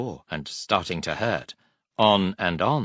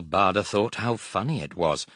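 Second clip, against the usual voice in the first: plain reading voice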